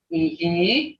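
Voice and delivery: ihi stretched slowly with an audible tone; long toned ihi. Shown as a demonstration. Teacher showing the word meaning yes, long ihi. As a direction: draw out both vowels of ihi. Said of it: "Hear that tone?".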